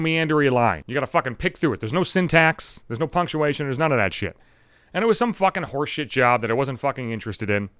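ordinary talk

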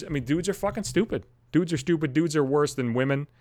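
usual speaking voice

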